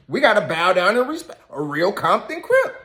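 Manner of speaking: mock awe at a real Compton Crip; sarcastic mock reverence. Host ridiculing the guest's brag, sarcastic mock awe. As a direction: sneer it out like it deserves bowing. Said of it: "Put emphasis on it".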